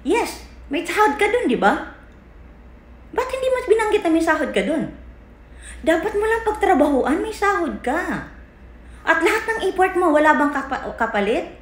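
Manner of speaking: real indignant outburst, high and quick; heated protest. Real, not acted. Heated confrontation over the pay.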